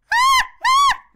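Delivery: very high, loud screeching shriek; high monkey screech. Shown as a demonstration. Showing viewers the high pitched monkey screech. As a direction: screech as high as you can. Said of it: "get the higher pitch".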